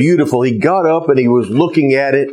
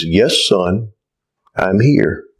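lower, calm voice from nowhere; voice of God; 6 semitones lower, slower than usual. Acted. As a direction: answer gently from above. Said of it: "this voice out of nowhere says".

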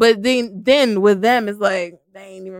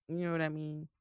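ordinary talk

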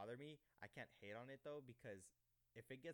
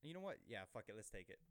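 normal talking voice

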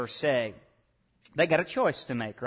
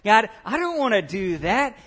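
acted defiant protest, raised pitch; mock rebellion; 4 semitones higher, 5 dB louder than usual. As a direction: push back like someone refusing an order. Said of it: "that rebellion that stirs back up in us and says".

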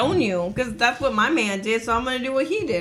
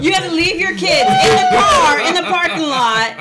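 raised, higher voice, loudly re-enacting what was said; emphatic retold confrontation; 6 semitones higher, 6 dB louder than usual. Telling friends what she said to the crying woman, loud and emphatic. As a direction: push it out loud over the others' reactions.

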